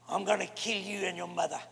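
low, soft menace; boxer's threat. Acted. Boxer facing his opponent nose to nose, low quiet threat. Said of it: "That's why the boxers".

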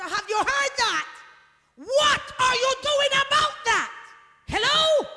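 high, loud pulpit demand; shouted challenge. Real, not acted. Preacher pressing a silent congregation for an answer, shouted challenge. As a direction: shout the question out to the whole church.

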